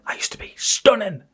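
hushed whisper of a faded beauty; whispered quote. Retold or imitated. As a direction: breathe it out like a secret. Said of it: "But she went".

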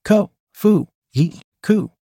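single words, each set apart by a pause; disjointed inner monologue, word by word; fragmented thoughts. A narrator's reading. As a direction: break it into isolated, halting bursts. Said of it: "couldn't even make full words".

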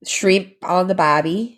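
put-on stock Aussie catchphrase accent; Australian accent. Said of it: "an Australian accent to share".

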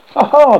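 loud, high "ha, ha" laugh; mocking laugh. A narrator's reading. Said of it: "laughed the musician".

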